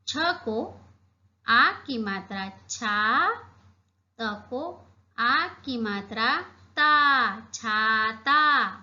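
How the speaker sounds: slow, drawn-out spelling of छाता syllable by syllable. Shown as a demonstration. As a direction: stretch each part of छाता slowly for the class. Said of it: "क्या पढ़ेंगे हम इसे?". (धीरे-धीरे मात्रा जोड़कर छाता पढ़ना)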